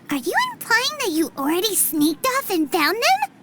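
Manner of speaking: ordinary delivery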